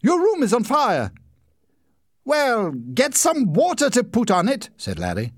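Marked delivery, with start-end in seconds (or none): 0.0-1.1 high, loud wake-up cry; mother waking her son in a burning room; urgent shout. A narrator's reading. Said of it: "she shouted". 2.2-4.7 high, offhand order; man in bed bossing his rescuers; lazy command. A narrator's reading.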